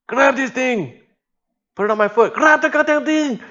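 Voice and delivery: reach down and urge him to grab hold; strained urgent call. Retold or imitated. Leaning over the boat, calling high and strained to the diver. Urging Darius to grab his foot.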